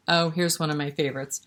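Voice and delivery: normal talking voice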